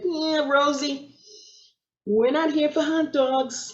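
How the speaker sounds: lightly voiced sheepish policeman breaking bad news; reluctant cop. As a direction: drag it out softly, as a reluctant cop. Cops reluctantly answering her greeting.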